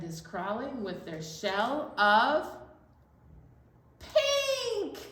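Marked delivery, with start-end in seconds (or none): 4.0-5.0 high, loud pink; triumphant reveal of the color; big reveal. Acted. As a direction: sing out the answer way up high. Reveals the rhyming color word.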